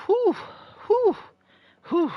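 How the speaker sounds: high-pitched relieved whews. Real, not acted. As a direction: blow out relief in high little puffs. Relief after bathroom break.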